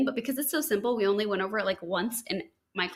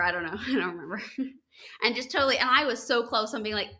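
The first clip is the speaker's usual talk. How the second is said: usual speaking voice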